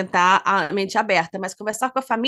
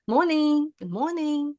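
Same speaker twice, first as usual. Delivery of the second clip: lightly voiced passer-by greeting, pitched higher; higher, drawn-out morning greeting; imitated friendly greeting; 5 semitones higher, slower than usual. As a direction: call a lilting hello to fellow dog walkers.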